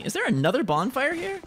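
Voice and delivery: normal talking voice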